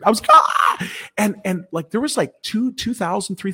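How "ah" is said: loud, airy mock scream; sudden breathy "aah" of mock shock; imitated shocked scream. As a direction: re-enact your own startled scream. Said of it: "I screamed".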